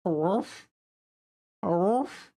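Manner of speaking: playful high woof at the dog; mock dog bark. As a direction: bark like a little dog for fun.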